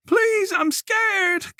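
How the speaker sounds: very slow, high, frightened plea; small scared voice. A narrator's reading. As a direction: beg from behind the door. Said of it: "Colette said".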